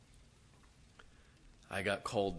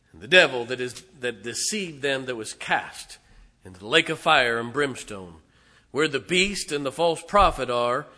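loud scripture reading; 3 semitones higher, 14 dB louder than usual. Real, not acted. Preacher reading Revelation to his church, loudly. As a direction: proclaim the verse to the rafters.

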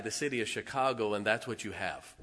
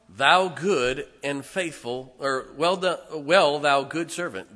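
raised, slow preaching of the verse; scripture reading; 2 semitones higher, 7 dB louder than usual. Acted. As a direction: proclaim the verse with weight.

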